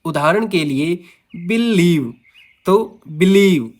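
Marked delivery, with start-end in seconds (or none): 1.4-2.1 believe with weight on लीव; light बि, then a stressed लीव; stress on second syllable. Shown as a demonstration. Teacher giving an iamb example, stress on लीव in believe. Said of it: "लीव है वो stressed है". 3.1-3.7 believe drawn out slowly, weight on लीव; slow believe, pressing on लीव; stress on second syllable. Shown as a demonstration. Teacher repeating an iamb example, stress on लीव in believe. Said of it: "लीव है वो stressed है".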